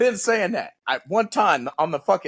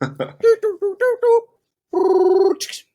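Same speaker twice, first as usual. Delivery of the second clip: high, louder mock ring tone; phone ringing; 13 semitones higher, 5 dB louder, much slower than usual. Calling his buddy, imitating the phone ring up high. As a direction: hum the dial tone up high.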